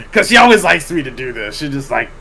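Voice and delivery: normal talking voice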